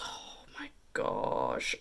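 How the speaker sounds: breathless whispered gush, slow; awed whisper. Real, not acted. Vlogger swooning over a palette's metallic row, in a slow awed whisper. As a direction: whisper it in awe, drawn out.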